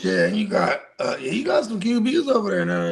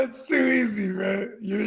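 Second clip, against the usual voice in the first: ordinary talk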